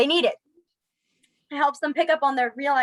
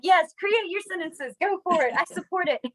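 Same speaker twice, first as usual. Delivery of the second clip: excited cheering; 8 semitones higher than usual. Real, not acted. Teacher urging students to make sentences, excited. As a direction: egg the class on happily. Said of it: "I get so excited".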